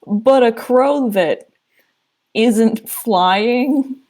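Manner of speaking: plain reading voice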